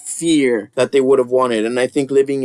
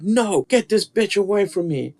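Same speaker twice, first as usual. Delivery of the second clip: higher-pitched put-on panic; mock freaking out; 6 semitones higher than usual. Imitating the coven lady as Rosemary walks up, mock panic. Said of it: "freaking out".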